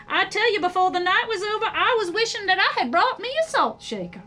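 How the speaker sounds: a mother reminiscing with a dry chuckle; lightly voiced Mother Worm. A narrator's reading.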